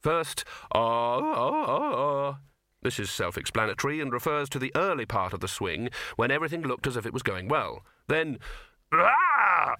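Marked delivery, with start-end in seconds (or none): 0.7-2.4 rising, wavering "ah-ah-ah-ah"; whooping cries in mid-swing; swinging ape's cry. A narrator's reading. Librarian swinging on a rope, whooping ape cries. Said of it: "transcribe the noises made during the flight". 8.9-9.8 loud, high sustained "aaah!"; falling scream. A narrator's reading. Librarian missing his mark on the rope, loud high scream. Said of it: "This was the noise made as he missed".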